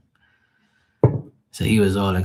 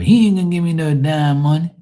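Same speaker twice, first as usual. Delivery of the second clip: scornful outburst; 8 semitones higher, 6 dB louder than usual. Real, not acted. Mocking dad's stinginess.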